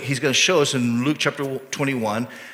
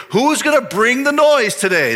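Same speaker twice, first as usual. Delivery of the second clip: loud, high-pitched rallying cry; playful, raised-voice challenge to the room; hyped-up callout; 10 semitones higher, 6 dB louder than usual. Acted. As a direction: call out like hyping up a crowd. Acts out givers hyping up noisy offerings.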